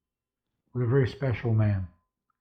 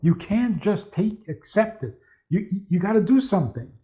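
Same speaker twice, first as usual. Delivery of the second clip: higher-pitched imitation of an urgent worrier; anxious insistence; 7 semitones higher than usual. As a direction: act out a worrier demanding action. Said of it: "they feel that being calm is irresponsible".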